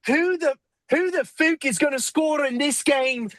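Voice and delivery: a pub-shouting Irishman with a hammed-up accent; shouted Irish accent. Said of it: "you just need to talk like that".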